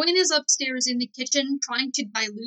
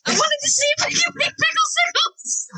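much higher, squealed self-defence; high-pitched defensive yell; 4 dB louder than usual. Acted. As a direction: defend yourself in a high shout. Acted quarrel, defending pickles in freezer.

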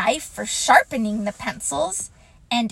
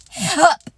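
high loud hup; a little squeaked hiccup; 7 semitones higher, 7 dB louder than usual. Acted. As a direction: Roo hiccups again. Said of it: "said Roo again".

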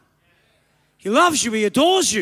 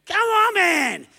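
ordinary delivery

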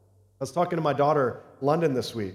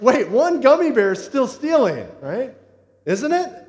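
much higher and louder, pressing in mock alarm; incredulous dad; 9 semitones higher, 8 dB louder than usual. Dad pressing his daughter about the gummy bear, higher, mock alarm. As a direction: press her, mock horrified. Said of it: "I was like".

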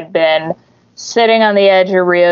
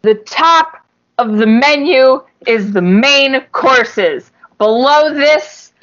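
higher, deliberate menu explanation; imitated scolding server, pitched up; mock lecturing; 5 semitones higher than usual. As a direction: spell it out for a clueless customer. Acting out restaurant woman explaining menu.

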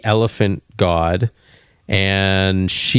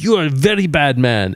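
a light mock Indian accent, a bit higher; imitating Indian accent; 5 semitones higher than usual. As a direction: wag the finger in a put-on Indian accent. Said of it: "I said to him with a Indian accent".

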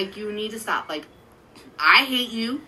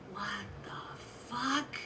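slow, soft disbelieving murmur; stunned mutter; 16 dB quieter, much slower than usual. Retold or imitated. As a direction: let it drop out slowly in shock. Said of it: "I'm like".

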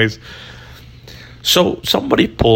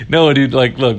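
usual speaking voice

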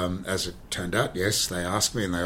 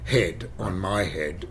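usual speaking voice

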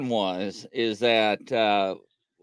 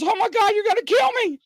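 mock begging for his life. Officer imitating the vagrant begging not to be killed, high panicked pleading. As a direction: squeal out the pleas like a terrified man. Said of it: "And he's begging for his life".

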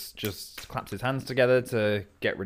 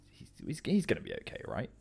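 hushed, almost voiceless, halting; worried whisper; 2 semitones lower, 14 dB quieter than usual. Retold or imitated. Friends muttering nervously about their host, whispered. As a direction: whisper it so he can't hear.

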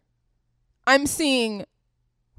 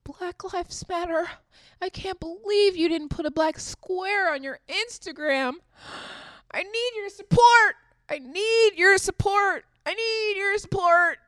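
much higher, whiny mocking activist; 8 semitones higher than usual. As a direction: parody a needy supporter in a high voice.

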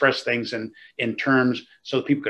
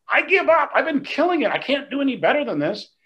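exasperated giving up; 13 semitones higher than usual. Retold or imitated. Pastor retelling giving up on wife's note, exasperated high wail. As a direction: throw up your hands in mock despair. Frustrated pastor's retold second thought.

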